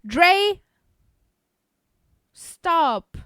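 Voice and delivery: loud sharp call; shout. Real, not acted. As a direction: call his name out sharply. Calling out to someone.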